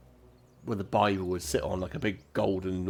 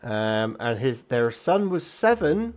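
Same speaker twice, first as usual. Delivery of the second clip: informative records clerk; 5 semitones higher, 6 dB louder, slower than usual. Acted.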